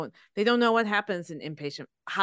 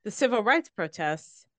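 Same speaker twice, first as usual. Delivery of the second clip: normal talking voice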